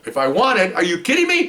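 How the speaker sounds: high-pitched, thrilled disbelief; excited chief. Retold or imitated. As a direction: climb high as if offered a huge prize. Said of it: "He's all excited".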